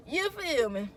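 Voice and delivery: ordinary talk, nothing marked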